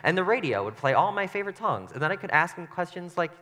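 normal talking voice

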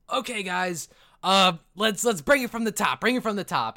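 rally the crowd like a circus boss; goblin ringleader. Acted. Goblin ringleader calls the crowd to restart, loud showman voice. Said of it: "the ringleader says".

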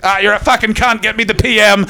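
high-pitched sweary Scottish politico impression; Malcolm Tucker. Comic imagining the Doctor swearing in the Tardis, as Malcolm Tucker. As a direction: do a ranting, foul-mouthed Doctor. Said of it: "wanna see him as Malcolm Tucker".

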